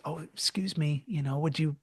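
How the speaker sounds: soft, timid, almost whispered ask; gentle request. Retold or imitated. Timid conductor gently asking the woman, whispered. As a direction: barely dare to ask, whispering. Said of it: "they may gently ask".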